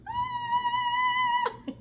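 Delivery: very high, loud squeal breaking into laughter; mock scream. Hearing he nearly died, high mock scream into laughter.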